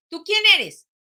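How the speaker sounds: a lightly voiced instructor asking; questioning. Instructor asking the jumper mid-flight, lightly acted. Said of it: "a preguntarle".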